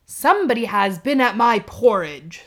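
loud, gruff papa bear complaining; rough gruff bear. Acted. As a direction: growl it out as the biggest bear. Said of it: "rough, gruff voice".

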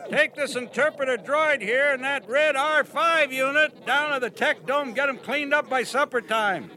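loud, high orders shouted over; calling out. Acted. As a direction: bark the chore at him from afar. Owen calling orders across yard.

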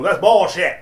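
put-on loud protest from a drunk customer; retold angry customer. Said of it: "He starts getting loud".

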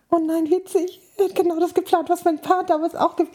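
high, quick amused thought; amused surprise. Retold or imitated. Conny realizes her ex copies a couple's proposal, high amused. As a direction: gasp it in delighted recognition.

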